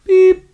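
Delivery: very high, loud electronic beep; imitated machine beep. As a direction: chirp it like an answering machine ending. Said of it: "there's an answering machine message".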